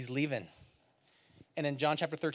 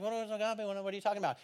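quick puzzled aside, higher pitched; mock confusion; 5 semitones higher, 4 dB quieter, much faster than usual. Disciples question the new command, baffled. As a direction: play the baffled listener muttering back.

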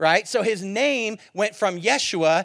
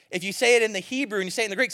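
normal talking voice

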